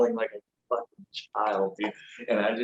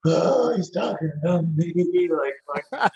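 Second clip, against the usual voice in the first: high, loud burst of giddy excitement; retold inner squeal, much higher and louder; mock giddy squeal; 8 semitones higher, 4 dB louder than usual. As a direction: gush like a thrilled fan, hammed up. Retold gleeful reaction to praise.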